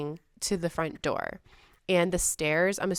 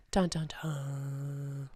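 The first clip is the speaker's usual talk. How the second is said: slow low suspense sting; dramatic dun dun dun; 4 semitones lower, 6 dB quieter, much slower than usual. Pretend. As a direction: hum a horror movie sting. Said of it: "mock suspense sting".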